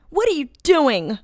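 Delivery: high, loud, alarmed question; demanding. A narrator's reading. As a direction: demand an answer urgently. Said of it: "I demanded".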